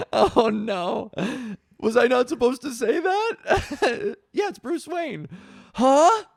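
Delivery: high, giggly mock dismay; flustered laughter. Real, not acted. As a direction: laugh helplessly at your own blunder. Flustered after blurting a secret.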